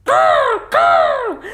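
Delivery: high cawing crow calls; squawky high crow cry; crow caws. Acted. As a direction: caw like a happy crow. Crows calling in the story.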